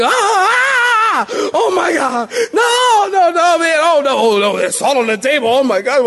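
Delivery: high, loud panicked shouting over salt on the table; mock freak-out. Pastor acting out a guest who sees salt on the table, in a loud, high, panicked mock shout. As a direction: ham up a panicked yell of no, no, no. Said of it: "I see a box of Morton's on your table and I freak out".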